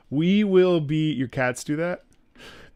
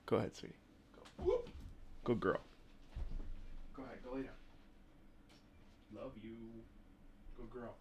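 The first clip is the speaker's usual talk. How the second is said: soft dog talk; 3 semitones lower, 21 dB quieter, faster than usual. Real, not acted. Softly sending dog to bed.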